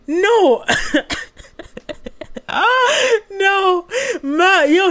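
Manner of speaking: high, drawn-out "no" through laughter; half-laughing, half-crying squeal; laughing mock wail. Real, not acted. Mock dismay at forgetting Matthew, laughing.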